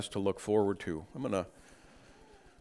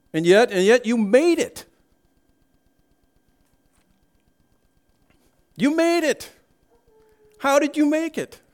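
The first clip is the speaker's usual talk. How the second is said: much higher and louder, marvelling; amazed; 5 dB louder than usual. Acted. Preacher imagining heaven, amazed and high. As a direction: cry out in astonished wonder.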